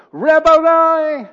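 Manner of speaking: high, drawn-out full-voiced shout; loud cry. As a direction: cry out at full force in joyful recognition. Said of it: "She cried out in a loud voice".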